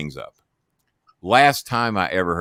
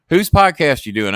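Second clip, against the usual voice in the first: higher, louder put-on voice of the caller; voicing Jimmy; 7 semitones higher, 6 dB louder than usual. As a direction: squawk it like the friend on the phone. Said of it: "Jimmy on the phone".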